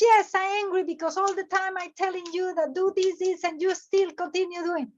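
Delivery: high-pitched, mock-exasperated rant; angry complaint. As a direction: let out a pent-up complaint in one run. Acting the angry person's complaint.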